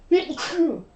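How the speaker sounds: a real sudden sneeze; sneezing. Real, not acted. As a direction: let a real sneeze burst out. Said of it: "excuse me, I'm gonna sneeze".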